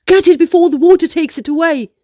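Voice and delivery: loud, fast hurried shout; excited hurried yell; urgent yell. Acted. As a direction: rush the words out before the wave. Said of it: "Ocean yelled".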